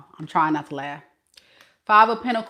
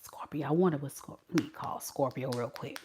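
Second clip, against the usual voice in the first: low, soft muttering to yourself; hushed, plotting aside; quiet scheming; 4 semitones lower, 11 dB quieter than usual. Acted. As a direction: mutter it as you sneak a call. Acting out Scorpio's ex calling.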